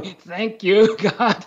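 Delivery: laughing thanks. Retold or imitated. Relieved owner thanking God.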